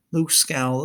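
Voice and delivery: ordinary delivery, nothing marked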